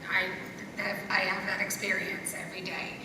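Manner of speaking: ordinary delivery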